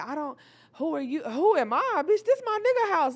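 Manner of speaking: high, loud, indignant yell, building; mock screaming back. Other woman yells back.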